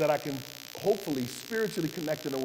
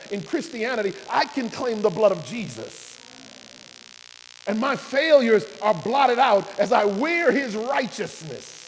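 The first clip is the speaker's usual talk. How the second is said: louder, rising preacher's build to the climax; fervent preaching; 4 semitones higher, 6 dB louder than usual. Real, not acted. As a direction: preach it with mounting fire.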